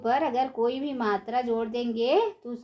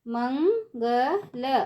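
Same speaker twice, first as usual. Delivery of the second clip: Mangal stretched slowly, syllable by syllable; slow syllable drill; much slower than usual. Shown as a demonstration. Teacher drawing out Mangal slowly for children. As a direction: draw out Mangal for the class. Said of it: "ऊंची आवाज़ में कैसे पढ़ेंगे".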